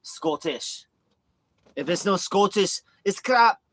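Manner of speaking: high, hammed-up Scottish accent. Streamer playing his Scottish friend, exaggerated Scottish accent. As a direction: build to a loud mock-Scottish declaration. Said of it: "I love Scottish accent".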